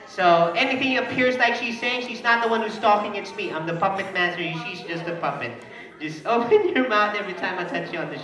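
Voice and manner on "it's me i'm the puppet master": usual speaking voice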